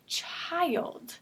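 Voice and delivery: put-on "child" slow, with the I stretched out; long I. Shown as a demonstration. Teacher introducing the long I word, slow stretched "child". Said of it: "for our long I".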